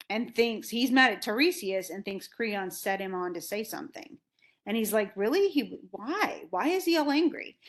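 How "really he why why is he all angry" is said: high, puzzled questioning; retold disbelief, pitch jumping up on the questions; taken aback. Creon hears that the king is mad at him, baffled.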